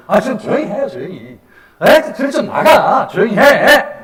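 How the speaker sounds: loud, high scolding from the crowd; annoyed bystanders. Acted. People walking alongside hushing the blind beggar, annoyed scolding. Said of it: "그 옆에 가는 사람들".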